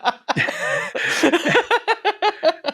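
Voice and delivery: high burst of real laughter; a long high laugh breaking out; big laugh. Real, not acted. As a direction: crack up at the memory. Laughs at the clerk's reply.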